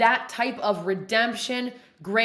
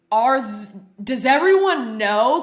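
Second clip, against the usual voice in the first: louder, slow alarmed question; sudden paranoia; mock panic in the prayer circle; 7 dB louder than usual. Retells panicked thought in prayer circle.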